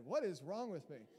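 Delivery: ordinary delivery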